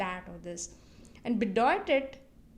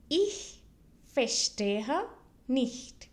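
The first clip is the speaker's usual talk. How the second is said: slow German, a pause after each of "Ich", "verstehe", "nicht"; word by word; much slower than usual. Shown as a demonstration. Teacher models the phrase for learners to repeat, word by word. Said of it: "repeat it twice so that you can listen".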